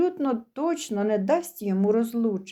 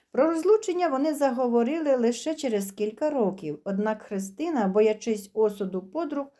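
usual speaking voice

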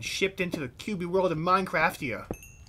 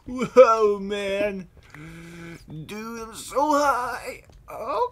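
mock tripping awe; 7 semitones higher, 4 dB louder, much slower than usual.

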